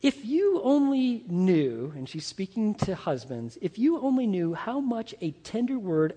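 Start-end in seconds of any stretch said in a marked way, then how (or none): 0.0-1.9 slow reading. Shown as a demonstration. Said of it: "she said this".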